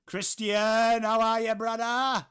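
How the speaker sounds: loud, high, drawn-out hello; shouted greeting. Real, not acted. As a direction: holler a big greeting to a mate.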